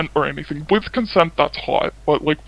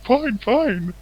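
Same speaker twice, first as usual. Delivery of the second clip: higher-pitched grudging mimicry; put-on huffy concession; mock surrender; 8 semitones higher than usual. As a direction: imitate someone backing down, pitched up. Retelling man giving in.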